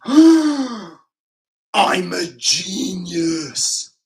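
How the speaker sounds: hammed-up loud, high cry of genius; gasp, then draw it out in mock amazement; mock epiphany. Hammed-up self-mocking epiphany after gasps.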